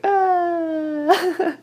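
high drawn-out fake yawn; a put-on yawn to trick the viewer. Shown as a demonstration. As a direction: pretend to yawn, long and high. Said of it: "That one was actually a lie".

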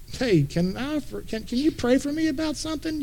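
mock sweet request. Preacher mocking a churchgoer who fakes a prayer request to gossip.

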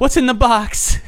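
high, dramatic desperate yell; movie quote impression. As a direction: shout it like a desperate detective. Repeats famous movie line.

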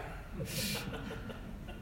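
breathy quiet chuckle; quiet rueful laugh; soft laugh. Real, not acted. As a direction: laugh at yourself under your breath.

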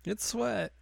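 high character echo; mock crying denial. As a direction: repeat the excuse way up high. Echoes the character's crying excuse.

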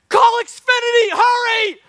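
high, loud comic panicked yell; mock yell. Man with a broken leg yelling to his friend, hammed-up panic. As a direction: holler it like your leg just snapped. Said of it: "you yell at one of your friends".

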